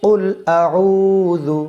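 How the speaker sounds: আউযু with the উ held one alif length; drawn-out recitation. Shown as a demonstration. Teacher demonstrating the elongated উ in আউযু to students. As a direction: stretch the উ in আউযু, slow and chanted. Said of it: "উ এক লিপ টান হবে".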